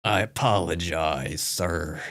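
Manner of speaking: low, slow character voice; deep put-on voice, politely announcing doom; mock-polite threat. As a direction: play a low, unhurried henchman. Acting a second game character.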